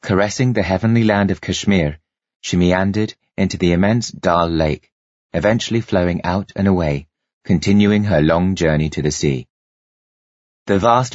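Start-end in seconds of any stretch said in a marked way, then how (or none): none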